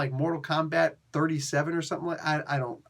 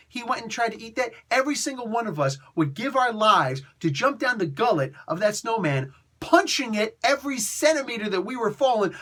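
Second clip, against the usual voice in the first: usual speaking voice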